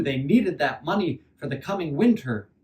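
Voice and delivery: ordinary delivery, nothing marked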